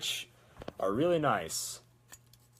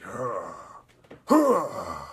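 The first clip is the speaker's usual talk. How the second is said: gruff, strained wheeze; loud, breathless old-soldier huff; laboured breathing grunt; 3 semitones lower, 7 dB louder than usual. Shown as a demonstration. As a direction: heave out a rough, airless grunt. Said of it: "he can't breathe".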